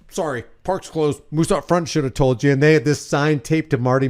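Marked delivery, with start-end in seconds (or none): 0.1-2.5 softer, flat deadpan guard voice; John Candy line. Retold or imitated. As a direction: mutter it like a bored park guard. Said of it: "John Candy comes out and goes".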